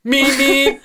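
high, loud double beep; squeaky mock horn honk; imitated moped horn. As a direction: toot it like a cheeky moped horn. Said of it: "gives them a little toot".

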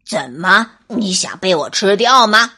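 低沉凶狠的威胁; 像恶狼一样恐吓; 恶狠狠的大灰狼. Acted. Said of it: "大灰狼恶狠狠地说着". (恶狠狠地威胁)

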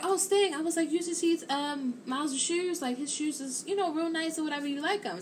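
high, sugary coaxing voice; sweet coaxing. Retold or imitated. Mother coaxing her son to give up the shoes, high and sweet. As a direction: sweeten it up to talk him into it.

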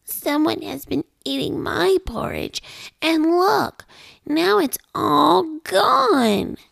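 tiny bear voice, high and slow on all gone; Baby Bear voice. A narrator's reading. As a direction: cry out like an upset little cub. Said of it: "Baby Bear said".

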